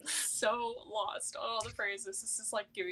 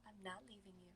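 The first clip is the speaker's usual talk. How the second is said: hushed, barely voiced reassurance; tender near-silent whisper; soft whispered promise; 21 dB quieter, faster than usual. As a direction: breathe it softly as a promise. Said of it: "it's just like".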